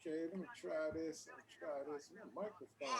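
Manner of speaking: faint, far from the mic; words barely above a whisper; barely audible. Real, not acted. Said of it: "you're barely above a whisper".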